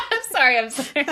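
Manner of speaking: high laughter breaking through an apology; real laughing fit. Real, not acted. Co-host apologises after faking surprise, helpless laughter. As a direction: crack up, squeezing out sorry between laughs.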